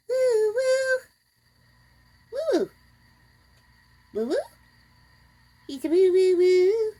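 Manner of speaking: high, loud woo calls; cooing at cat. Real, not acted. Woman calling to her cat with high woo-woo sounds.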